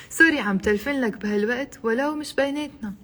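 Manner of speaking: fast, run-together example dialogue; quick natural speed. Shown as a demonstration. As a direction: rattle the exchange off fluently. Teacher models whole exchange at speed.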